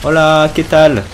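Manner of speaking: slow, loud Spanish greeting; Spanish accent. Pretend. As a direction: greet them like a Spaniard, drawn out. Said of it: "je suis, euh, espagnol".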